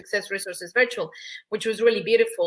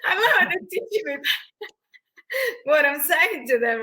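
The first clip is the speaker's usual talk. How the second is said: high-pitched, laughing through the words; laughing delight; 5 semitones higher than usual. Retold or imitated. Mother amused her kids teach her own lesson, laughing. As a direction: let the laugh break up the line.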